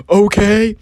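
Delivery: high, loud eager okay. Retold or imitated. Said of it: "I wanted to believe it so bad".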